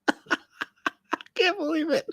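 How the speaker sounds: ordinary talk, nothing marked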